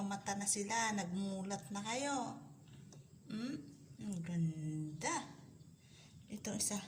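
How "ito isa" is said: ordinary delivery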